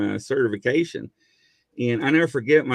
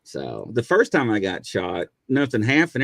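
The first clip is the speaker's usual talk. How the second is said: normal talking voice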